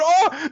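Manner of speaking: high mock-wounded "oh"; cartoon yelp of dismay; shocked gasp-cry. Dog accused by the child, mock shock. Dog's jaw drops, shocked.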